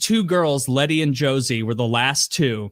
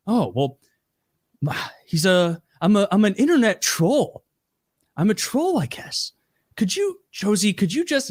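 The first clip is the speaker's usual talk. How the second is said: halting, higher-pitched awkward admission; hesitant mock confession; 6 semitones higher than usual. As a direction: imitate someone sheepishly owning up, voice rising. Retold troll's halting, feigned-innocent confession.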